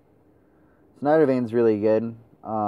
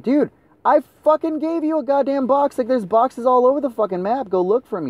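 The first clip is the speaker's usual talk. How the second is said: high-pitched exasperated outburst; frustrated gamer rant, pitched way up; angry rant; 12 semitones higher than usual. Real, not acted. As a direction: yell it in a rising rage at useless teammates. Venting at teammates over boxes.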